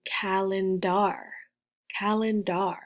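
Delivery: "calendar" ending in a full "dar", rhyming with car; wrong full-vowel ending. Shown as a demonstration. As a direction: say "calendar" as "CAL-en-DAR", with a clear AH at the end. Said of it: "not calendar".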